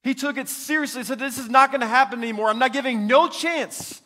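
loud, high fiery preaching; preaching at full force. Acted. Preacher telling the church how Elijah slaughtered Baal's prophets, fiery loud preaching. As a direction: thunder it out from the pulpit.

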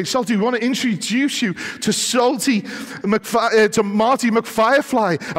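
high, loud, eager introduction; excited kids. Acted. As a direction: announce a new friend with breathless excitement.

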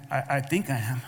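low, hushed, hesitant answer; acting out a half-hearted believer; unsure reply. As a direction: mumble it softly, as if not quite sure. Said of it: "Some of you are going like this".

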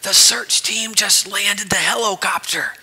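put-on high, voiceless child whisper full of awe; awed whisper. Child whispering to the boss about the helicopter. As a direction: whisper it wide-eyed. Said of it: "In an awed, whispered voice".

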